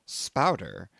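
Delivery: usual speaking voice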